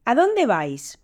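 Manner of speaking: mild narrated call-out. A narrator's reading. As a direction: call to them as the ram, lightly acted. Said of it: "el carnero les gritó". (llamada en voz aguda, sin gritar de verdad)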